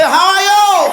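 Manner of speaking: high, loud hail; loud call to the crowd. Real, not acted. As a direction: call it out to the room.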